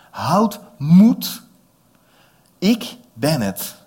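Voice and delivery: loud call across the water, slow ik ben het. Acted. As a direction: shout it over the storm. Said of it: "De Heer Jezus riep dat natuurlijk. Heel hard". (luid roepend over het water)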